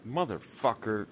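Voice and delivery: normal talking voice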